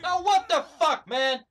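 loud, high exasperated yell; angry outburst. Real, not acted. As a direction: yell in disbelief at the game. Player rages at the game.

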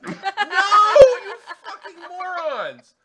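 high, loud and slow screaming rage, breaking into laughter; laughing furious shout. Acted. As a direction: scream it at the guards while cracking up. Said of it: "She's talking about herself".

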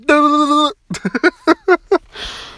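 a high-pitched laugh that falls away; real laugh of disbelief; high burst of laughter. Real, not acted. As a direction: crack up, high and bright. Reacting to in-game disaster.

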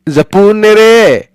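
a fond warning drawn out; caring grandmother. Acted. Grandmother calling after Sonya to carry it carefully, fond.

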